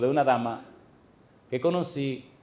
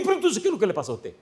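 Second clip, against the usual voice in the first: high, fast, urgent question; pressing question; 8 semitones higher, faster than usual. Retold or imitated. Preacher pressing the silent church lady, quick and high. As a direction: push her for the answer, quick and high.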